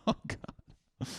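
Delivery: whispered laughing groan; breathless laugh. Real, not acted. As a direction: laugh it off under your breath. Breathless laugh after joke.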